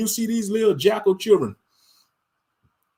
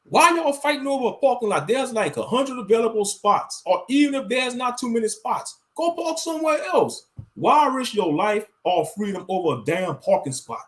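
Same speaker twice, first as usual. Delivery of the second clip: higher, exasperated rhetorical questioning; exasperated disbelief; 6 semitones higher than usual. Retold or imitated. Ranting at drivers fighting over parking, higher exasperated disbelief. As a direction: throw up your hands at it, pitched up. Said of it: "I be like".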